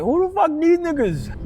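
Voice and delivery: high, slow, stunned question; shocked. Retold or imitated. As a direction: draw it out in disbelief. Strangers suddenly pull up.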